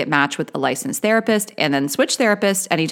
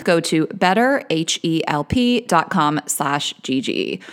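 slow ad read; slower than usual. Shown as a demonstration. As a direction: spell out the web address slowly. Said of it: "reading ad code slowly".

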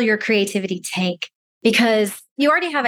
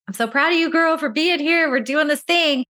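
higher-pitched, encouraging self-talk voice; acted self pep talk; 7 semitones higher than usual. Client tells herself she is proud, encouraging pep talk. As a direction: cheer yourself on, in a higher voice.

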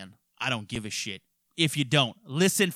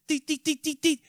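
mock phone dial beeps; 11 semitones higher than usual. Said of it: "I fucking dialed her on my goddamn iPhone".

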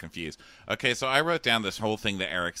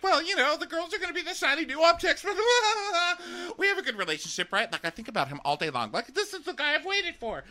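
much higher, gushing lovestruck imitation; high mocking girl voice; 3 dB louder than usual. Mocking a smitten contestant to her mother, in a high gushing voice. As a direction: ham up a smitten reality star. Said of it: "she's like".

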